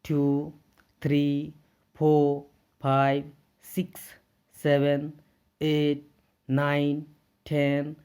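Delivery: clear, deliberate counting drill; counting aloud. Shown as a demonstration. Teacher models counting for the class. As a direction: model the numbers for students. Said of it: "तो हम लोग क्या पढ़ेंगे".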